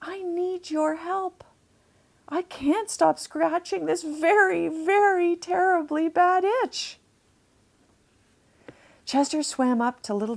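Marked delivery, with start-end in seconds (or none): 0.0-7.0 higher, pleading manatee voice; hopeful plea. Acted. Chester begs Janny for help, higher and hopeful. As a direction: ask for help with hope in every word. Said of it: "Chester thought hopefully".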